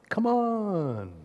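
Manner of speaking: normal talking voice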